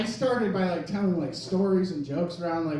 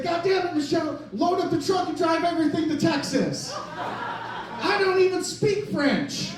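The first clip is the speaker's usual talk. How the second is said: high, loud panicked yell into the phone; panicked shout; 8 semitones higher, 6 dB louder than usual. Retold or imitated. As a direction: Louisiana soldier yelling to his wife about fleeing to Texas. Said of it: "and said".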